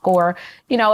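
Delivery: ordinary talk, nothing marked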